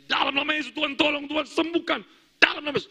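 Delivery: high-pitched urgent plea to God; urgent prayer. Retold or imitated. Pastor praying over a collapsing man, urgent pleading.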